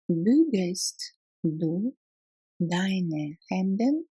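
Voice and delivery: very slow, split into two chunks; slow repetition. Shown as a demonstration. Teacher repeating the German question slowly for learners. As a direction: break it up slowly so learners can follow. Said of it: "teacher repeats slowly in chunks".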